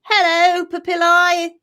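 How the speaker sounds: high, loud friendly hello, slow on the second line; lightly voiced cartoon cell saying hi; chirpy papillae greeting. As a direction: call out cheerily to a neighbour. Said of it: "They're like".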